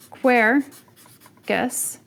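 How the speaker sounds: "quercus" stressed on its first syllable. Shown as a demonstration. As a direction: put the emphasis on the opening syllable of "quercus". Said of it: "put the emphasis on the first syllable".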